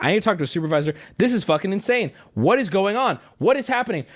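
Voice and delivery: ordinary talk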